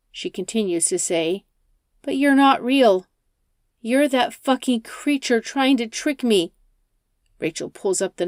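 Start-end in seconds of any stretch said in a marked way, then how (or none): 2.0-6.5 higher, louder accusing outburst; angry accusation. Acted. Rachel accuses the driver of being a creature, angry.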